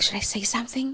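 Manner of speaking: breathy secret whisper, barely voiced; hushed whisper to a friend; retold whisper. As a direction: whisper it so nobody overhears. Eavesdropping, lying back in chair.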